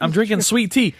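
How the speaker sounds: ordinary talk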